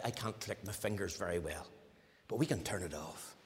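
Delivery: ordinary talk, nothing marked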